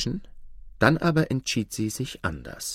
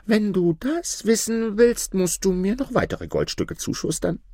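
greedy tailor; 11 semitones higher than usual. A narrator's reading. Greedy tailor asks for gold.

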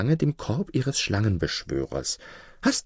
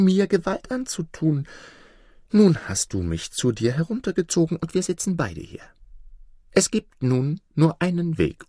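higher, lively voice of a cunning fox; sly fox; 5 semitones higher than usual. Acted. Fox persuading the wolf in the pit, sly and higher. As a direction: talk him round like a crafty fox.

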